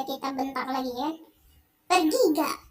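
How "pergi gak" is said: louder menacing demand; threatening. Acted. Husband threatening his wife to leave, louder menacing demand. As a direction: push her out with a threat.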